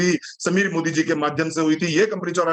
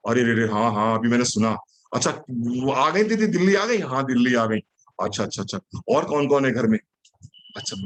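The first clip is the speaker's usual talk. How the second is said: patient networker role-play; 8 semitones lower than usual. Shown as a demonstration. Acts out a listening sales conversation. (धैर्य से सुनता networker, धीमी और नीची आवाज़ में)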